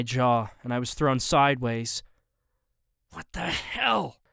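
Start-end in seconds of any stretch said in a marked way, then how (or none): 3.1-4.2 growled. A narrator's reading. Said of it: "I growled as I steadied myself".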